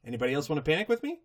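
very fast panicky chatter; rushed panic. Retold or imitated. Pinkie Pie to the crowd, fast and panicky. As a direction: rattle it off. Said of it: "and says".

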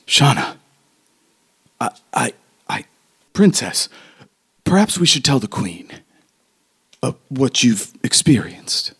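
stammer, then propose it carefully; hesitant Tobin. Acted. Tobin nervously suggesting telling the Queen.

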